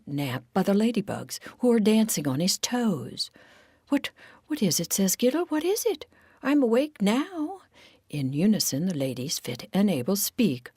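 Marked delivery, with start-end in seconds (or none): 3.9-4.9 quick, higher-pitched waking confusion; hurried, high questioning; startled awake. Acted. Giddle woken from a nap. 5.5-7.6 high-pitched, rising question; high, flustered waking voice; startled awake. Acted. Giddle woken from a nap.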